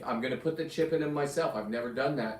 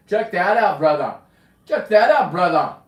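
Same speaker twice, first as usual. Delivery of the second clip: put-on loud, high playful Jamaican accent; 5 semitones higher, 12 dB louder than usual. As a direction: call it out in a mock Jamaican accent. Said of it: "I don't know why I went Jamaican on you".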